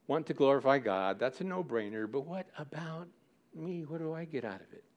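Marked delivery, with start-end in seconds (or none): 2.1-4.8 hushed selfish aside. Pretend. Preacher voices a selfish churchgoer, quietly grumbling. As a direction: mutter it like a selfish thought.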